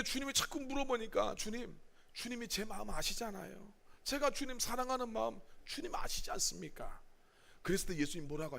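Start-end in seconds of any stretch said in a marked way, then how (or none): 1.4-7.0 much softer, hurt plea; lightly voiced pleading Peter.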